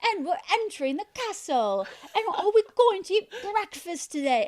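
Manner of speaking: hammed-up posh British accent, laughing at the start. Mimicking a K-pop star's bursts of British accent, hammed-up British. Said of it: "like, British accents".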